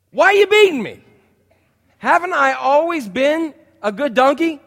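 high, loud whiny donkey; pleading donkey voice. Acted. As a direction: plead like a hurt, loyal donkey. Talking donkey pleads with Balaam.

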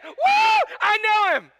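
high, loud joyful cry; excited Paul. Acted. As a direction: proclaim it defiantly with joy. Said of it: "But he said".

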